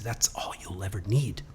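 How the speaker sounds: a calm teacher's confiding whisper; hushed sensei. Retold or imitated. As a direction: breathe it like a quiet secret. Sensei reassures the boy.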